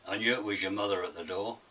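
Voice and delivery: ordinary delivery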